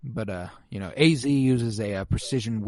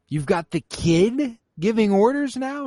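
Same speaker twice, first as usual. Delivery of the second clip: incredulous; 8 semitones higher than usual. Real, not acted. Podcaster to co-hosts, in high disbelief. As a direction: ask it in disbelief.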